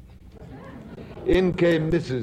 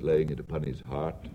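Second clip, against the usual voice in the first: usual speaking voice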